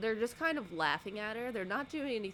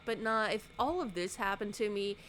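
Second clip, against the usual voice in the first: usual speaking voice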